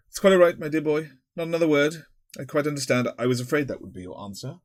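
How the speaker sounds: hasty reassurance. A narrator's reading. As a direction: rush to smooth it over.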